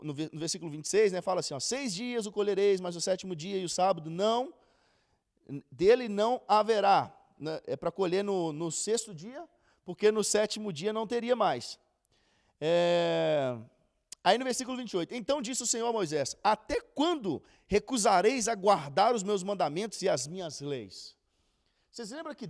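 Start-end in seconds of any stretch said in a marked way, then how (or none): 1.6-7.1 scripture reading. Acted. Reads verse twenty-six. 15.1-21.1 scripture reading. Acted. Reads verse twenty-eight.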